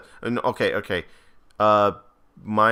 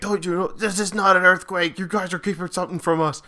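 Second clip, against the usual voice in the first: higher-pitched movie-trailer outburst, imitated; lightly acted accusation from a film trailer; Bryan Cranston; 7 semitones higher than usual. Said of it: "Bryan Cranston, uh, from Breaking Bad".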